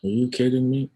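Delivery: high-pitched indignant question; incredulous outrage. Pretend. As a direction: throw it out in high, rising disbelief. Imagined parent reacting in outrage.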